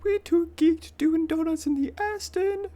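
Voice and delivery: high-pitched mock rap line, half laughing; mimicking the rapper. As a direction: squeak out the lyric like the rapper.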